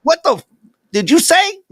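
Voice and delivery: a mocking cousin throws out a high, outraged Samuel Jackson line; Samuel Jackson impression. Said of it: "Samuel Jackson".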